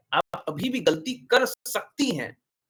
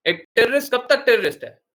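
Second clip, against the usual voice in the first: loud rhetorical question; 7 semitones higher, 9 dB louder than usual. Real, not acted. Rhetorical challenge to the listeners.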